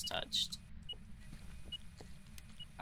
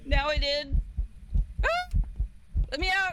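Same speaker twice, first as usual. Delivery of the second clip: loud, high panicked cries; panicked yelling; 6 semitones higher, 6 dB louder than usual. Real, not acted. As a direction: yell to be let out. Trapped during ghost hunt.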